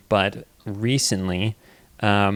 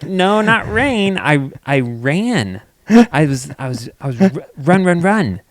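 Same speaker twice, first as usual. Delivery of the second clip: put-on talking to toddler; 6 semitones higher, 6 dB louder than usual. Explaining to toddler slowly.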